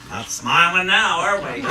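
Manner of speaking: loud, slow, playful jab; teasing the congregation. Real, not acted.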